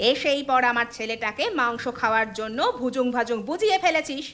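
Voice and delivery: put-on aunt telling the kids to leave or else; threatening aunt.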